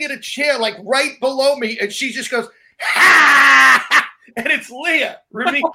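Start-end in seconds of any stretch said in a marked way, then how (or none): 2.8-4.0 loud, drawn-out mock cry; mimicked loud "ha". Host imitating the woman in the chair, loud outburst. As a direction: blast out one startling "ha".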